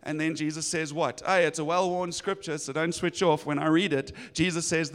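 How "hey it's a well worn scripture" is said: ordinary talk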